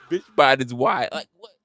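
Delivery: usual speaking voice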